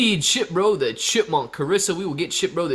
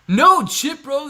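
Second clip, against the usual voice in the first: mock protest; 12 semitones higher, 8 dB louder, slower than usual. Mock outrage at chat comment.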